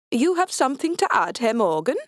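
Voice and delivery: put-on taunting Swedish-accented officer. A narrator's reading. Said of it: "Kasdan nods to an underling".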